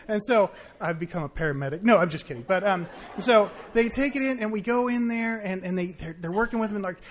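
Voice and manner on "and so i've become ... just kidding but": usual speaking voice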